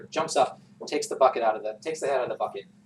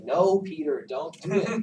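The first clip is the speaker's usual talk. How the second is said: mock kids pleading, higher; a playful imitation of children begging Peter not to; imitating the kids; 5 semitones higher, 4 dB louder, slower than usual. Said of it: "this is the point at which my kids always say".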